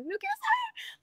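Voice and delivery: mock whiny refusal. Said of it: "Yo era como".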